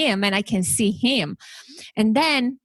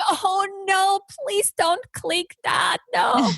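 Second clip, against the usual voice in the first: retold panicked scream; 12 semitones higher, slower than usual. Host watching her guest click the wrong button, high mock panic. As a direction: squeal it like watching a disaster unfold. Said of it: "I'm sitting here screaming".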